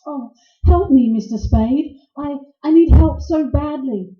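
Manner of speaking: loud, imploring damsel in distress; throbbing plea. Acted. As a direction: beg for help with a throb in the voice. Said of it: "that throb you get in your voice".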